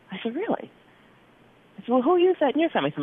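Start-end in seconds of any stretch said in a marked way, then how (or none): 0.4-0.7 sharp high-pitched disbelief; surprised really. Retold or imitated. Doctor startled by patient's answer, high surprised really. 1.9-2.9 higher, faster, louder curious asking; eager question. Retold or imitated. Doctor eagerly questions patient about the cure, excited and fast.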